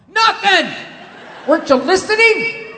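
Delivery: mother shouting at her son, mock indignant; mother's shout. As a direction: snap back at the child. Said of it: "What'd you have, Mom?".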